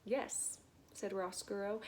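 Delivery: usual speaking voice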